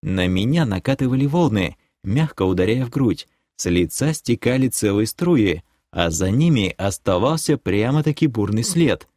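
ordinary delivery

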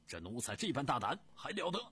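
loud, rising outraged shout; furious roar. Acted. Prince roars at the eunuch on stage, loud and outraged. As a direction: roar at the insolent servant. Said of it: "大喝一声".

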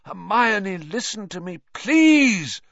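high, pleading shout rising on the last word. A narrator's reading. Lupin begging Hermione to listen, shouting. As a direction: plead loudly to be heard. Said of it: "Lupin shouted".

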